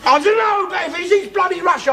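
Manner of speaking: high flustered yell; heated shout. Real, not acted. Heated family row.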